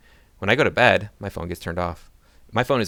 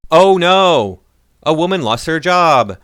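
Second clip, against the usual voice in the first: loud, high mock horror, slowing on the second line; exaggerated fake alarm at the news; sarcastic dismay; 7 semitones higher, 9 dB louder, slower than usual.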